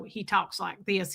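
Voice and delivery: slow, soft Tennessee drawl; Tennessee accent. Retold or imitated. Imitating a father from Tennessee, slow Southern accent. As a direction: talk like a Tennessee father, slow and low-key. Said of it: "my father is from Tennessee".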